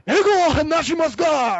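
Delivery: loud mock Japanese brute, strained upward. Buddy pretending to police he speaks no English, brutish yelling. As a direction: bellow like a put-on Japanese brute. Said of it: "in this deep Japanese brute voice".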